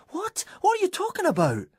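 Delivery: very high, fast bewildered cry; shocked disbelief. A narrator's reading. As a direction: blurt it out in disbelief. Banksy shocked, confused.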